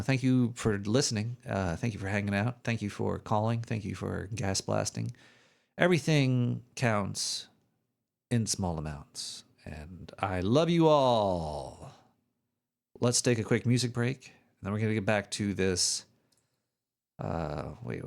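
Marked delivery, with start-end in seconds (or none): 5.8-11.9 slow sign-off. Pretend. Sign-off slogan.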